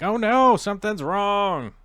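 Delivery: high, loud alarmed cry, slowing down; mock alarm. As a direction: gasp it out in fake worry. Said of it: "that makes me go like".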